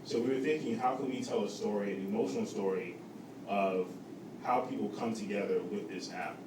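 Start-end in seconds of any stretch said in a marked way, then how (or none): none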